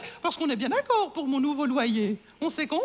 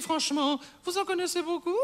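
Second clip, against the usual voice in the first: usual speaking voice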